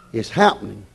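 ordinary talk

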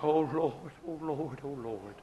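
low, very soft murmured prayer; hushed appeal to God, imitating private prayer; subdued retold weeping prayer. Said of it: "just a bawling and a crying".

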